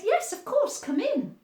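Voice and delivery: high, sing-song welcome; mummy's high cheerful yes; mummy's bright welcome. A narrator's reading. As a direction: greet the tiger brightly. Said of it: "Sophie's mummy said".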